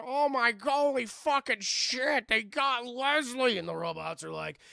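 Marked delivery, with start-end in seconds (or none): 0.0-3.6 higher, louder, slow horrified cursing; mock horrified shout; shocked outcry. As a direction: blurt it out in shock. Survivors see friend killed.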